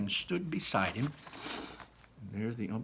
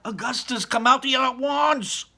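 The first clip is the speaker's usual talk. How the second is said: calling out; 10 dB louder than usual. Acted. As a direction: yell for her boy to come out. Mother calling son from pipe.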